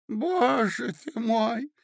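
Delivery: very high, slow lament; poor old prince. Acted. Bewildered prince amid the scandal, pitiful lament. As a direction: sigh it out in a piping voice. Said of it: "говорил бедный князь".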